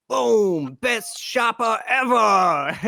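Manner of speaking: loud, slow, high announcer call; announcer shout. Pretend. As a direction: proclaim it like a game show host. Mock award announcement in store.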